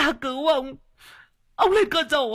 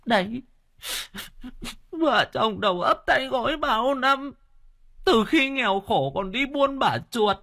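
much higher, crying complaint; wailing wife. A narrator's reading. Old wife lamenting to her husband, wailing and crying. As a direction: sob out years of grievance. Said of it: "bà Linh khóc lóc".

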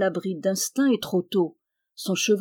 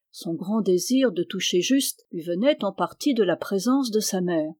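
ordinary delivery

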